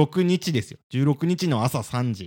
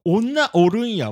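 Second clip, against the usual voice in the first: slow, drawn-out mock Kansai line, louder and higher; imitating Kansai dialect; 9 semitones higher, 5 dB louder, slower than usual. Mocking the co-host's Kansai slip on video, slow imitation. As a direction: parody the co-host's Kansai phrase, slowly. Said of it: "ってこれ関西弁じゃん".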